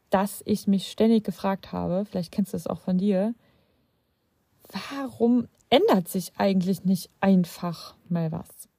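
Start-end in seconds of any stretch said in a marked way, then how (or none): 4.9-8.7 einfach pushed out as the key word of the question; stress on einfach. Shown as a demonstration. To the viewer, asking why nothing changes, stressing einfach. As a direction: lean hard on einfach, like a frustrated sigh. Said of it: "Betonung liegt auf dem Wort einfach".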